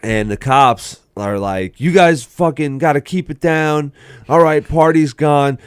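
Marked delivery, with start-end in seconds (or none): 1.8-5.6 loud, higher-pitched police order; raised voice of mock authority; imitated cop. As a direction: break up the party as a cop. Voices cops breaking up party.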